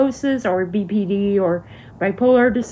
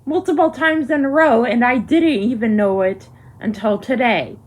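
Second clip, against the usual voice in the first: normal talking voice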